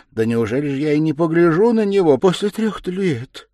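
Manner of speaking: loud, high, tearful protest; distressed mother pleading loudly; tearful wail. A narrator's reading. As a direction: wail it out, on the edge of weeping. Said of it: "заплакала Пульхерия Александровна".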